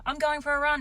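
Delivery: high, pointed mock defiance; wife snapping back at husband, high and defiant; defiant retort. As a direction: toss it back at him stubbornly. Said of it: "I was like".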